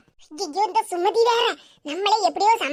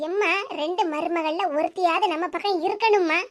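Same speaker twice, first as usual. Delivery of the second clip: ordinary talk, nothing marked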